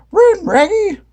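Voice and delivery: high, loud Scooby yelp. A narrator's reading. Scooby yelping at Shaggy to flee, high and loud. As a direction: bark the warning. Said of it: "Scooby yelped".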